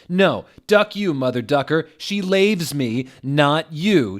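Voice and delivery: high, loud mock shouting match. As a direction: yell back, jealous and furious. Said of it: "shouted Vampire".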